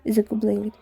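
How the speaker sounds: usual speaking voice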